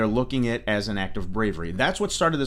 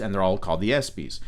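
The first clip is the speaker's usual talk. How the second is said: ordinary delivery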